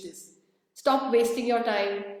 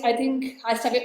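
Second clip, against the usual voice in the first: ordinary talk, nothing marked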